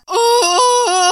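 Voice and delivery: high, loud tearful cry. Real, not acted. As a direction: let the oh break with tears. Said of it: "I'm actually got tears".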